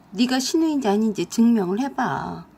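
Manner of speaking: ordinary delivery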